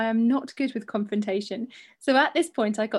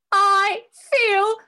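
higher, louder dramatic declaration; hammed-up outrage at the shop staff; 10 semitones higher, 6 dB louder than usual. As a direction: proclaim it like a melodrama. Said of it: "I said loudly and with drama".

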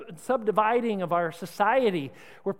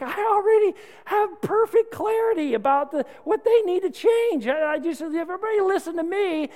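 higher, louder, smug know-it-all; mock the self-assured believer, pitched up; 7 semitones higher, 3 dB louder than usual. Preacher imitates Christians who claim perfect clarity, smug and higher. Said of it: "even Christians would say".

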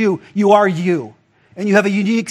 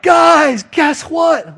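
high, loud, bursting with the news; excited shout; 7 semitones higher, 4 dB louder, much slower than usual. Acted. Woman calling out to the townspeople, loud and excited. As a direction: call the whole town over, thrilled. Woman runs to tell the town.

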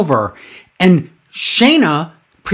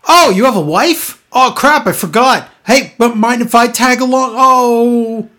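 higher-pitched, hammed-up dopey sidekick; dumb third wheel; 6 semitones higher than usual. Wrestler intruding on a married teammate, dumb third wheel. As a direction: play it clueless, pitched up. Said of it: "He's playing dumb third wheel".

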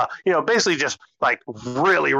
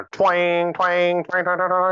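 higher voiced twang, twang like plucked strings; twang imitation; 4 semitones higher, slower than usual. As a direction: mimic the mandolin notes. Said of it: "she's hitting all kinds of twangy notes".

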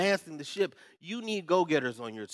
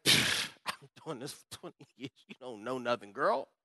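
dismissive scoff; 4 semitones lower, 4 dB quieter than usual. Retold or imitated. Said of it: "and I say".

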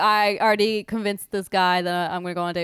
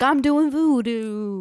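mock voodoo guy; 3 semitones higher, much slower than usual.

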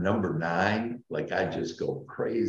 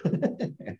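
giddy laugh; 12 semitones higher than usual. Real, not acted. Giddy laugh over nine.

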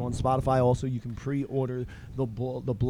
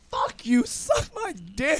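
higher, slow defiant jeer; mock defiant teen; 8 semitones higher than usual. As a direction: sneer it at the teacher, high. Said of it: "told him".

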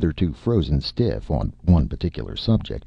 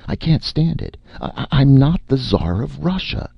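very high, louder panicked protest; hysterical, high-pitched outburst; wild; 13 semitones higher, 4 dB louder than usual. A narrator's reading. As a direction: stammer out a frantic denial. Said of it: "Martin said wildly".

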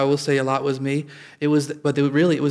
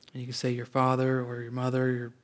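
usual speaking voice

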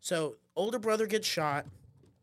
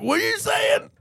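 high, loud mock cop; hammed-up police dispatcher straining to understand; cop on the phone; 6 dB louder than usual. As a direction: shout it like a baffled cop. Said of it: "The cop's like".

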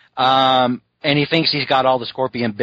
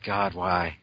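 soft, low despairing sigh; despairing groan; 7 semitones lower, 8 dB quieter than usual. Retold or imitated. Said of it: "he was like".

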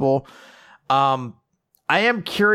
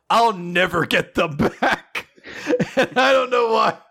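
laughing; 6 semitones higher than usual. Real, not acted. Fan quoting a favourite insult, laughing. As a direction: crack up on the words. Reading a line, cracking up.

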